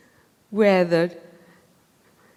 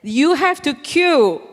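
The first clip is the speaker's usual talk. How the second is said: slow, firm, higher stern command; 6 semitones higher, 4 dB louder, slower than usual. Retold or imitated. Telling the queue-jumper to queue, slow stern voice. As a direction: lay down the law, word by word. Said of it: "So I put on my stern voice".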